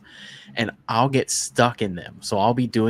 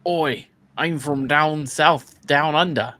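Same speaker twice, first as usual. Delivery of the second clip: hammed-up Aussie, slow and higher; Australian accent; 5 semitones higher, slower than usual. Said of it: "No, that was Australian".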